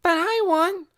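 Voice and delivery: put-on very high, loud whiny child; little boy starting to wail. A narrator's reading. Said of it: "started to wail".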